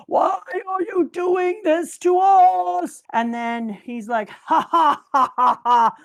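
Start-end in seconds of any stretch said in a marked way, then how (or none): 0.1-3.0 high, loud, slow anguished scream; king screaming at his people in anguish. Retold or imitated. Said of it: "the king is screaming". 4.3-6.0 loud evil mock laugh; villain laugh.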